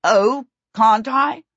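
slow, loud taunting question; defiant challenge. A narrator's reading. Prince answering the princess's legal objection, slow defiance. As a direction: draw it out as a taunt.

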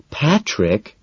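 a name said as a mild warning. A narrator's reading. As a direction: warn him off with just his name. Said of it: "she warns".

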